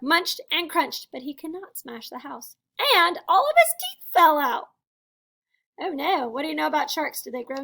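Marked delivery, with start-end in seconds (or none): 2.8-4.7 loud, high, gleeful punchline; climactic storybook reveal. A narrator's reading. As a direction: hit the surprise big and high.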